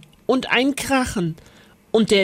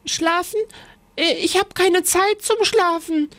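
high, startled little witch; much higher, alarmed refusal; 10 semitones higher than usual. A narrator's reading. Lisbeth refuses to sleep, startled. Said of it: "sagte Lisbeth erschrocken".

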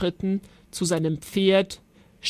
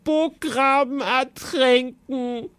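sobbing; 6 semitones higher than usual. A narrator's reading. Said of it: "schluchzte Griesbart".

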